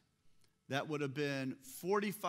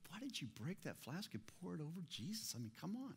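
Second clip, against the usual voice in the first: soft, higher, quick little objection; mild mock complaint; 6 semitones higher, 8 dB quieter, faster than usual. Preacher voicing a too-mild objection to the woman, softly complaining. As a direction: play a timid, half-hearted reproach under your breath. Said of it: "So it wasn't just a".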